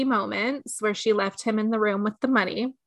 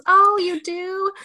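mock interested; 9 semitones higher, 4 dB louder, slower than usual. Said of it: "And she says".

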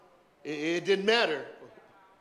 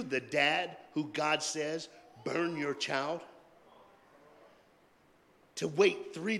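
slow emphasis; 2 semitones higher, slower than usual. Acted. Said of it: "slowed emphasis on the burden".

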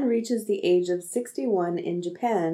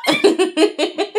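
self-exploration laughed out much higher; laughing; 5 dB louder than usual. Real, not acted. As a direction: blurt it out through a laugh. Laughs at her own phrase.

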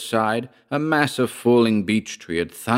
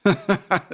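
high-pitched snarling cackle of a laugh; short snarling laugh; 12 semitones higher than usual. A narrator's reading. Gloating over his trapped enemy, snarling laugh. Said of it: "gave a short, snarling laugh".

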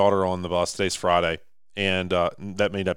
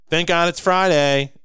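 high, slow put-on voice of a grumpy bus driver; a higher, slower mock of the bus driver; 9 semitones higher, 6 dB louder, slower than usual. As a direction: drag it out like the weary driver. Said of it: "And she's this big, fat, sloppy".

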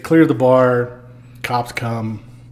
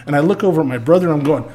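usual speaking voice

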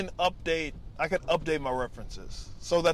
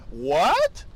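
indignant what; 7 semitones higher, 7 dB louder than usual. Pretend. Older person reacting to new joke.

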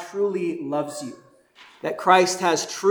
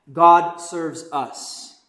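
usual speaking voice

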